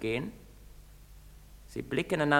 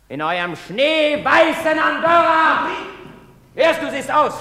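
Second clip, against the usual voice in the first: bitter shout; 12 semitones higher, 10 dB louder than usual. Acted. Andri shouts bitterly at the deserted town. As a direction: fling it out at the empty town.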